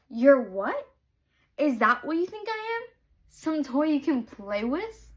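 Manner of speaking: loud, high indignant disbelief; outraged. Real, not acted. Reader firing back at the book's hero, indignant. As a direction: bristle as if insulted yourself.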